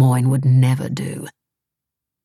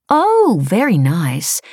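a high Oh, then slow very nice; impressed wife; 4 semitones higher, 4 dB louder, much slower than usual. Acted. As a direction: gush over the hotel, drawn out.

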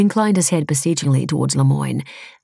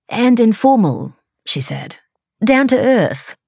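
higher and louder, slow and keen; eager wife; 8 semitones higher, 4 dB louder, slower than usual. Acted. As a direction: chime in eagerly to help her husband. Wife adds eagerly to husband.

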